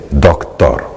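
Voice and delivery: low, darker shift on the name. Retold or imitated. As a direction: drop into a colder, pointed tone. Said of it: "cambia el tono".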